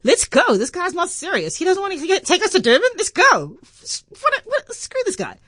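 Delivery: put-on cocky little girl. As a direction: play the defiant seven-year-old brushing dad off. Seven-year-old self defying dad.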